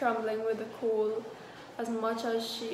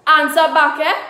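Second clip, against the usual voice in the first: loud, higher sputtering outrage; spluttering; 6 semitones higher, 19 dB louder than usual. Acted. Husband spluttering at his wife for answering back, loud. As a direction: sputter it out, choking on anger. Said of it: "spluttered Gurudeva".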